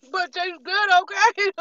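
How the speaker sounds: high, loud joking admission; playful squeal. Real, not acted. Admitting the food still tastes good, high and playful. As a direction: laugh off your own point.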